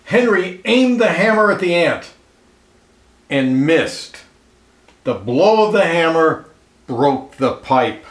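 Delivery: lively read-aloud storyteller voice, clear and even; storybook narration. A narrator's reading. Reading a picture book aloud to children, lively storyteller voice. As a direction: tell it like a picture book for kids.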